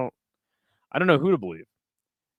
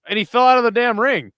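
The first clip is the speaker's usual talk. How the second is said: higher, louder burst of disbelief; excited; 8 semitones higher, 5 dB louder than usual. Real, not acted. Streamer watching the fighter fall out, excited. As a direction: blurt it out as he tumbles out.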